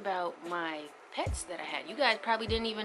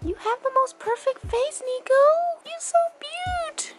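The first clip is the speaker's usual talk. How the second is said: dog talk; 7 dB louder than usual. Real, not acted. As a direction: gush at the puppy in a raised, louder coo. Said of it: "I got dog talk".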